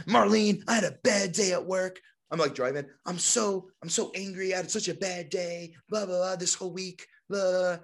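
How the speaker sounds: venting, hammed up; mock angry rant. On the drive home telling Marlene, mock angry rant. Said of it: "I was like".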